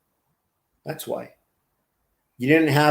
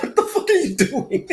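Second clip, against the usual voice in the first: high mocking voice; 12 semitones higher than usual. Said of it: "it's like".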